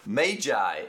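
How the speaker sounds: Magi said as MAY-jai; correct pronunciation. Shown as a demonstration.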